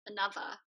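"Another" with the TH softened toward a V; British another. Shown as a demonstration. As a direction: say "Another" as anuvver. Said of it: "it's Fs".

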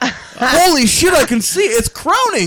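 high, slow mock horror; mock shock. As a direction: gasp out a hammed-up shock. Mock horror at imagined bottle.

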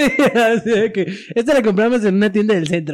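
giggling through the words; barely holding in laughter; laughing. Real, not acted.

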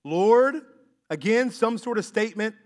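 usual speaking voice

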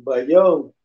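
usual speaking voice

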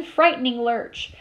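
ordinary delivery

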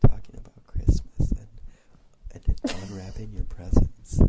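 close-up whispering; ASMR whisper. Shown as a demonstration. Host playing along with an ASMR episode idea, whispered. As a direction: whisper it right into the mic. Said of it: "A whole episode of A S M R".

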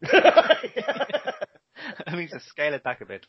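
slow, drawn-out fussy producer voice, laughing; mock producer. Mocking the film producers in a meeting, slow and laughing. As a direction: play a precious producer scaling it back.

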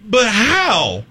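high-pitched, baffled questioning; exaggerated high bewilderment; incredulous. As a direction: protest in rising disbelief. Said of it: "I'm just looking at going".

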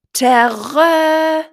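very high, very loud trumpet blast; elephant trumpet. Acted. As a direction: blast it out like a proud baby elephant. Little elephant trumpets again.

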